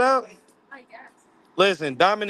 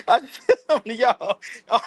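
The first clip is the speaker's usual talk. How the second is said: laughing after a near fall; 8 semitones higher than usual. Real, not acted. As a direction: laugh through the words. Almost fell, laughing.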